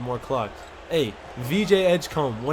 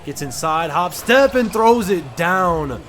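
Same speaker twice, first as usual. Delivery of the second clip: excited dunk call; 3 semitones higher, 7 dB louder, slower than usual. Real, not acted. Gamer calling his player's dunk, excited shout. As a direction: build to a big, slow punch on the dunk.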